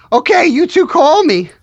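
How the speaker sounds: high, goofy cartoon slacker voice; Shaggy. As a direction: lift it into a loose cartoon stoner call. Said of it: "the voice was supposed to be Shaggy".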